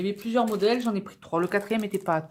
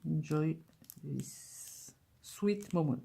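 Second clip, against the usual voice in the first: slow, attempted English accent; 5 dB quieter, much slower than usual. Real, not acted. Vlogger reading a stamp to viewers, English accent. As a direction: read it slowly in French-tinged English. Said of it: "C'est avec mon accent anglais".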